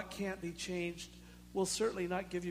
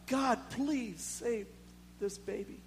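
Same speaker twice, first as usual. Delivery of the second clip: slow, higher, begging plea to God; pleading prayer; 3 semitones higher, slower than usual. Retold or imitated. Father praying for his dying baby, slow pleading.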